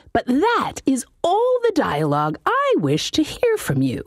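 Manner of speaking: high, put-on voice of a fussy old halfling mage; high-voiced wizard. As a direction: whine it like a pompous little wizard. Wizard lectures his demon.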